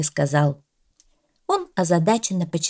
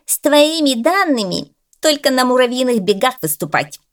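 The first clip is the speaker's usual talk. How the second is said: sneering jab; 7 semitones higher, 5 dB louder than usual. Acted. Bulldog mocks dog.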